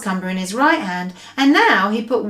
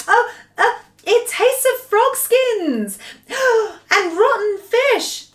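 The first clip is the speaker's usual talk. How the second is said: a much higher, slow, revolted complaint with a gasp; disgusted; 13 semitones higher, 3 dB louder, slower than usual. Acted. Sophie, revolted, describes the taste to the giant much higher and slow. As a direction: gag on every word.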